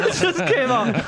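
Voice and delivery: laughing. Real, not acted. Still cracking up.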